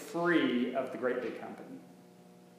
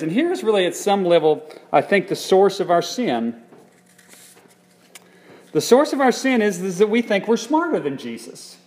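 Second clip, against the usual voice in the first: much louder, higher driving point; preaching emphasis; 5 semitones higher, 10 dB louder than usual. Acted. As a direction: hammer home the source of sin.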